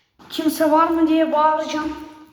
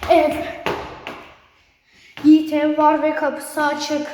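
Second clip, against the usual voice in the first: ordinary delivery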